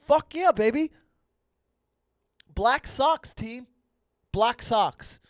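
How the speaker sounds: high, loud hype-up; triumphant cheer. Real, not acted. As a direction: whoop it like a team chant.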